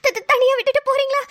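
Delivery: high, stuttering nervous plea; panicked stammer. Acted. Wife scared of being left alone, panicked stammer. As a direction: stutter in fright, pitch climbing.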